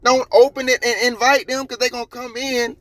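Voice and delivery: high-pitched, incredulous rant; exasperated scolding. Real, not acted. Vlogger warning a driver not to invite police in, exasperated rant.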